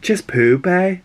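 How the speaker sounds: put-on quick quoted line in a Liverpool accent; playful mock Liverpool accent; Jamie Tart. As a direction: mimic a Scouse footballer, pitch lifting at the end. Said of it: "in his little Liverpool accent".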